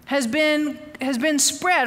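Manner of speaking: ordinary talk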